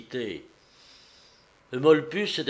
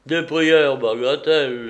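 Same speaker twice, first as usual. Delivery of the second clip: louder, brusque repeat of "prières"; scoffing dismissal; 2 semitones higher, 7 dB louder than usual. A narrator's reading. Neighbour answering the praying father, scoffing dismissal. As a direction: brush "prières" off loudly as nonsense.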